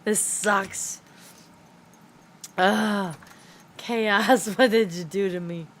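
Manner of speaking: ordinary delivery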